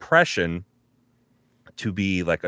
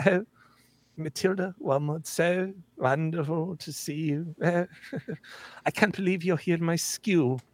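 much higher, soft Scottish lady; falsely sweet Scottish headmistress; Trunchbull voice; 9 semitones higher, 5 dB quieter than usual. Retold or imitated. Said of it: "Trunchbull. It was very much".

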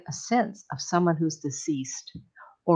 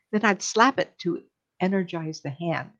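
normal talking voice

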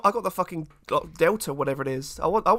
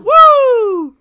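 loud whoop; 16 dB louder than usual. Real, not acted. Hyped cheer together.

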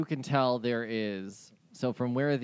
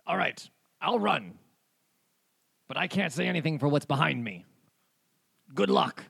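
fleeing pirate; 3 semitones higher, 3 dB louder than usual. Acted. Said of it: "he goes".

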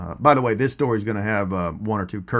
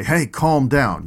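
showing the wrong way, louder, higher and slow; mock yelled calm down; 5 semitones higher, 6 dB louder, much slower than usual. Shown as a demonstration. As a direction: bark it out the way you shouldn't. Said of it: "you don't yell at them to calm down".

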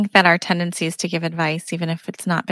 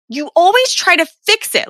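complaining much higher and louder, fed up; exasperated wife; 9 semitones higher, 4 dB louder than usual. Retold or imitated. Wife tells her partner off, exasperated and much higher. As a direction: let the frustration spill out at him.

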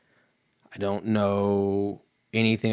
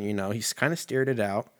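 usual speaking voice

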